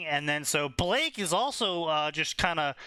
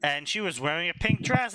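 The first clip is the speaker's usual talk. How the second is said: ordinary delivery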